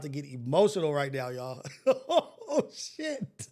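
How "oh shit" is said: relieved laugh. Real, not acted. Laughs off near-crying.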